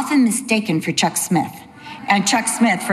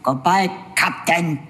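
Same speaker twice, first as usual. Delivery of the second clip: stranger's parting line; 4 semitones lower than usual. Retold or imitated. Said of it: "the man turns around and goes".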